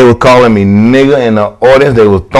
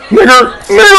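high, laughing mock chant; mock crowd taunt. Crowd taunting the wrestler, high laughing mock chant. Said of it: "I'm like".

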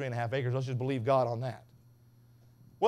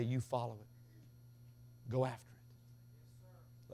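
slow, much softer, near whisper; hushed command; 3 semitones lower, 13 dB quieter, much slower than usual. Acted. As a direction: drop right down and say it slowly. Quiet command after shouting.

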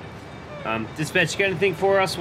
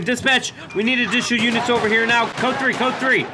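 urgent shouting; 7 semitones higher than usual. Pretend. Calling backup, ordering suspects down.

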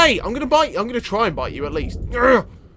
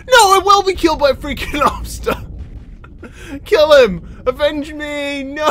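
overacted death scene; 7 semitones higher, 4 dB louder than usual. His fish dying, dramatic last words.

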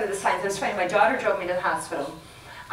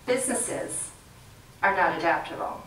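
ordinary delivery

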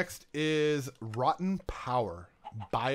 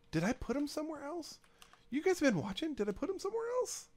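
panicked squeak. Real, not acted. Panicked searching for lost items.

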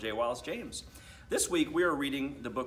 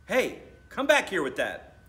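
higher, loud call after her; 8 semitones higher, 8 dB louder than usual. Acted. Brother chasing sister down the hall, loud call. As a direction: yell after the running sister.

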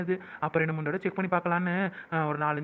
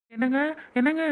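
louder call with rising end; 5 semitones higher, 4 dB louder than usual. Acted. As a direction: call him urgently from the other room. Calls husband after test. (கணவனை அழைக்கும் குரல்)

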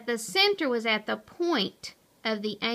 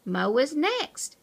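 usual speaking voice

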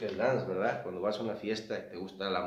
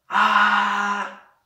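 put-on a high, loud scream of excited kids; children's yell; 9 semitones higher, 12 dB louder than usual. Shown as a demonstration. Teacher acting out children yelling at the circus, high loud scream. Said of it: "Children yell".

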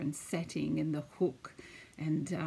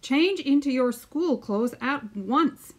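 louder, higher stern order; angry dad; 8 semitones higher, 7 dB louder, slower than usual. Acted. Dad ordering Billy to change, angry and loud. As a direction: snap it like a cross father. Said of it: "His dad was mad".

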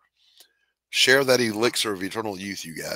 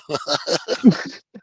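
high, loud burst of laughter; sudden high laugh; real laugh; 10 semitones higher, 5 dB louder than usual. Real, not acted. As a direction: crack up at your own joke. Laughing at own joke.

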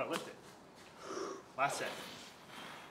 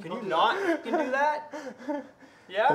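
laughing scold; 10 semitones higher, 7 dB louder, slower than usual. Real, not acted.

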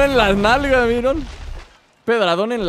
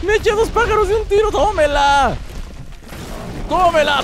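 high-pitched victory crowing; triumphant gloating; 9 semitones higher than usual. Real, not acted. Player celebrating two kills with one shot, triumphant gloating.

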